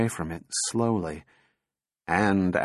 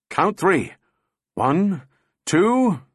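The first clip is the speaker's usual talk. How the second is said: high, separated count, rising to the last; calling the count; 8 semitones higher, slower than usual. A narrator's reading. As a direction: count it out urgently, pitched up. Counting down to the throw.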